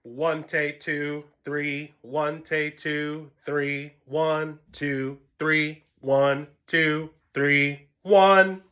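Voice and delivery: slow, even, measured count in a monotone; steady rhythmic counting, each beat spaced out. Shown as a demonstration. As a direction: count the beats slowly and steadily, level pitch. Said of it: "where we have".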